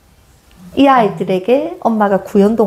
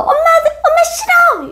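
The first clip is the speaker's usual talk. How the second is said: put-on bratty child. Child fighting with mom, high bratty shriek. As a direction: whine it out like a fighting kid. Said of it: "아이가".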